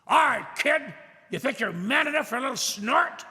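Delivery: high, loud jeering sailor voice; taunting sailor. Retold or imitated. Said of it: "turned to me with this bottle of whiskey and said".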